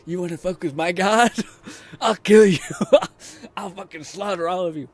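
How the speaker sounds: high, loud mock rage with laughs; hammed-up furious god threat. Streamer imagining the sequel hero, loud mock rage.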